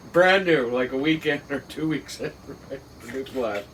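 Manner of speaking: ordinary delivery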